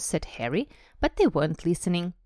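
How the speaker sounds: usual speaking voice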